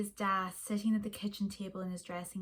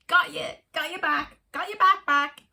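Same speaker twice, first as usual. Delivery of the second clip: playful taunt; 6 semitones higher, 8 dB louder than usual. Acted. Kids laughing and bumping in play.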